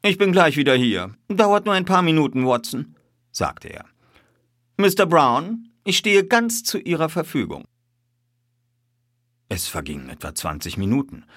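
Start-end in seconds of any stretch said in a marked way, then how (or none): 0.0-2.9 high, lively aside to a friend; raised, cheerful detective voice; breezy reassurance. A narrator's reading. Detective reassures his companion. 4.8-7.7 high, airy politeness; lightly triumphant detective; suave courtesy. A narrator's reading. Detective courteously yields to boss.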